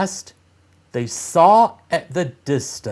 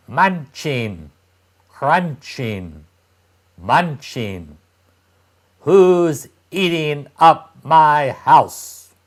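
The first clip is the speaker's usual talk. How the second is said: louder, higher, slow question from inside; the hidden witch demanding who eats her house; witch's voice; 3 semitones higher, 4 dB louder, slower than usual. A narrator's reading. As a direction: call out like the witch catching thieves. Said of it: "a voice came out of the cottage".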